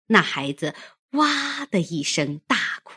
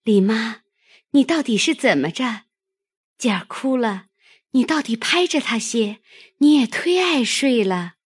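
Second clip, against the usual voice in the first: loud, higher call across the room; 5 semitones higher, 4 dB louder, faster than usual. Acted. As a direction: scold the nurse loudly from afar. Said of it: "平儿连向那边叫道".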